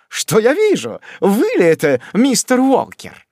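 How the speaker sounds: high, bright feigned surprise; joyful exclamation. A narrator's reading. Job feigns joyful surprise at meeting Sam, high exclamation. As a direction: greet him as a long-lost friend. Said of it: "воскликнул весёлым тоном".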